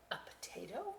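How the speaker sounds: soft unvoiced question; puzzled whisper. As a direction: whisper it in disbelief.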